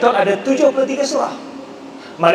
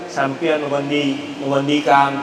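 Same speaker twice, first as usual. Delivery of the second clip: far-off Sabah dialect; 6 semitones lower than usual. Shown as a demonstration. Lecturer quoting a distant Sabah speaker, Sabah dialect. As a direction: say it like a remote villager. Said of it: "dia cakap".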